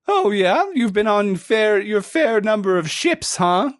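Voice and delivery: high squeaky voice, doubtful; skeptical otter shipwright. Acted.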